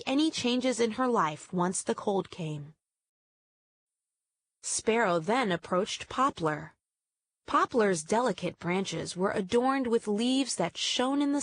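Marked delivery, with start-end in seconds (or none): none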